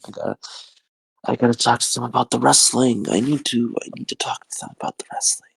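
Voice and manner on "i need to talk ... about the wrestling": whispered to himself, tired. Real, not acted. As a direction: breathe it out as a whisper.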